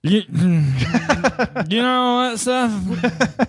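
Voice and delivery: booming tipsy uncle; drunk uncle voice. Pretend. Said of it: "saying this in drunk uncle voice".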